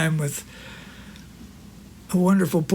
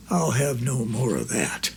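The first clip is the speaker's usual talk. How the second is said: mock growl; 6 semitones lower, 4 dB quieter than usual. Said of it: "he growled".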